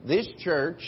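normal talking voice

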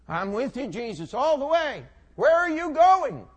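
high, loud, eager declaration building to a shout; eager pledge. Acted. Peter pledges to follow Jesus all the way, eager and loud.

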